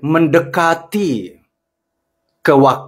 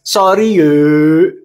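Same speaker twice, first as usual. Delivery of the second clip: sassy sorry; 3 dB louder, much slower than usual. Acted. Host brushes off critics with a sassy sorry. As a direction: toss it off like a diva. Same sassy catchphrase repeated.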